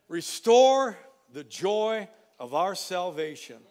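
loud, slow reading of the verse; solemn scripture reading. Real, not acted. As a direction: lay each word down solemnly. Final scripture read slowly.

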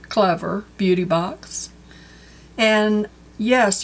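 ordinary talk, nothing marked

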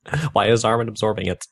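deadpan dramatic fanfiction reading; mock fanfic reading. Podcaster reading fanfiction to cohost, deadpan storytelling. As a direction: read the bad fanfic aloud with a straight face. Reads fanfiction aloud, mocking.

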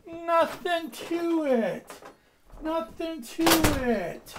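high, slow swagger; cocky bird. Acted. A cocky bird brushing off the danger. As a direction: boast it slowly up high.

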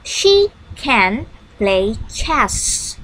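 She can play chess said very slowly; slow and clear teacher model; slow model sentence. Shown as a demonstration. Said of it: "Yuk, kita coba yuk di dalam kalimat".

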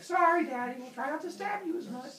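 high-pitched kiddie apology; apologetic children. Acted. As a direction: play the kids saying sorry, voice way up. Children apologise to scolding father.

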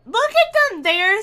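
high and loud; excited. Real, not acted. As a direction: exclaim in delight. Excited at game scene.

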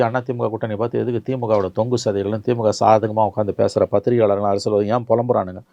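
ordinary delivery

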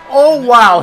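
loud disbelief. Real, not acted. Gamer reacts to a teammate missing an easy steal, loud disbelief. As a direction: blurt it out at the blunder.

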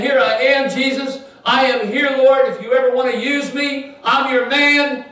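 hammed-up eager disciple crying out; eager shout to the Lord. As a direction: call out like you're ready to serve. Ananias offering himself to Jesus.

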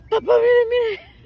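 put-on high, loud childish wailing plea; crying to dad. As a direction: cry out to papa like a hurt little girl. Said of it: "y que yo me dejo una lloradera". (llanto de niña, agudo y fuerte)

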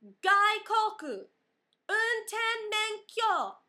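high, loud, very slow Japanese; slow loud clerk voice. Retold or imitated. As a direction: spell it out loudly to the foreigner. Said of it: "looking directly at me and going".

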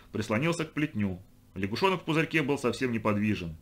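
ordinary delivery, nothing marked

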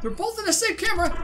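ordinary talk, nothing marked

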